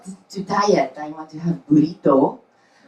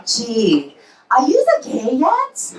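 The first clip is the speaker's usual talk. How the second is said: put-on slow, high Japanese-accented question; a nosy mother in a thick Japanese accent; mom's Japanese accent; 4 semitones higher, much slower than usual. Japanese mom on the phone asking her daughter, thick accent. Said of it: "She calls me".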